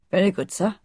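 lightly voiced old servant, deferential; respectful gardener. A narrator's reading. Gardener accepts his young master's orders, lightly deferential. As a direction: say it as a dutiful servant would. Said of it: "replied Mister Roach".

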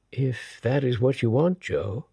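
a hint of a tender lady; Samandi's voice. A narrator's reading. Samandi replies gently.

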